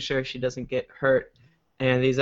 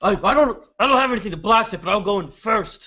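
heroic knight voice; 5 semitones higher, faster than usual. Acted. Same character keeps talking.

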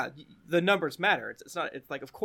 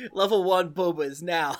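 higher, louder, amused delivery; laughing while talking; 5 semitones higher, 7 dB louder than usual. Real, not acted. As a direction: say it through real laughter. Joking about Boba, cracking up.

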